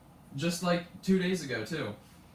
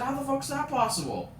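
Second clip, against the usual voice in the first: high-pitched incredulous dad; imitated disbelief; 10 semitones higher, 3 dB louder than usual. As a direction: question it as an astonished dad. Quotes dad's disbelief at report card.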